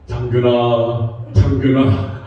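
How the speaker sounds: slow, low solemn call; God calling by name. Acted. Imagined God calling from the burning tree, solemn acted call.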